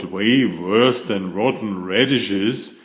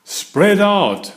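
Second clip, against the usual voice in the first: directing call; 9 semitones higher, 4 dB louder than usual. A narrator's reading. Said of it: "Pete directs".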